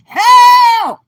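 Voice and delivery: hammed-up high scream, stretched out loud; screaming liver. Liver calling on the Holy Ghost, comic desperate scream. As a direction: scream high, then drag out the last words. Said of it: "that liver over there saying".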